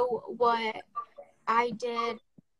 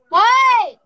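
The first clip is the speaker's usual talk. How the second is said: a much higher, very loud what; jolted, she calls out sharply; loud high startled question; louder startled what; 10 semitones higher, 16 dB louder than usual. Real, not acted. Startled by someone behind her.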